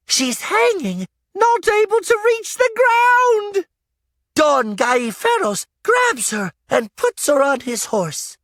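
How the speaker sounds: put-on a boy caught up in the drama; excited boy narrator. A narrator's reading. Boy narrating the chase to the audience, excited and high.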